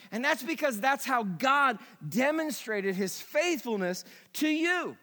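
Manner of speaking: ordinary delivery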